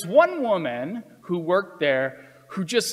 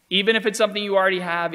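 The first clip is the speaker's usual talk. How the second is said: quick lecture; 4 semitones higher, much faster than usual. Retold or imitated. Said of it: "and she said".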